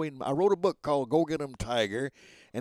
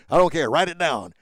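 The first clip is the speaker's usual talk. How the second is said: insistent CEO; 3 semitones higher, 12 dB louder than usual. Retold or imitated. CEOs insisting he write it.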